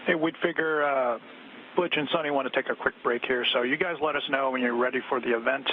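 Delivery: crackly radio transmission; like a space-to-ground radio link; astronaut radio. Real, not acted.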